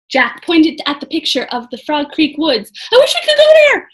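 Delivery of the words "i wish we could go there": high loud wish; shouting. A narrator's reading. Jack shouting his wish to go home. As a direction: shout it urgently. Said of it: "Jack shouted".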